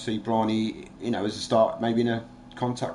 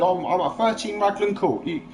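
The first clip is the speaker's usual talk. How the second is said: higher-pitched, goading invitation; retold confrontational dare; 7 semitones higher than usual. Daring the stepdad to come round, confrontational challenge. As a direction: re-enact daring a rival to turn up.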